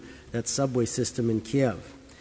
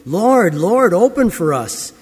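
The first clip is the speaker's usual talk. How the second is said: loud, urgent plea at a shut door; pleading call; 4 semitones higher, 8 dB louder, slower than usual. Acted. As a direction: call out begging to be let in. Shut-out people knocking, pleading.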